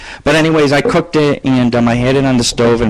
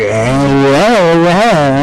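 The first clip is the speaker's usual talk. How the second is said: mock teasing moans; 2 semitones higher, much slower than usual. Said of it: "on purpose".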